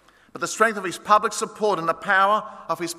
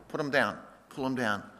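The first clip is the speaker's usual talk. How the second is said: fading to hush; 6 semitones lower, 9 dB quieter than usual. Acted. Lights put out at festival end.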